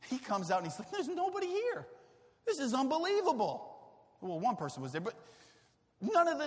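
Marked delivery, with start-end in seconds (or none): 0.9-3.7 high, disbelieving; indignant disbelief. Pretend. Jesus finding the disciples gone, high indignant. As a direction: huff in disbelief.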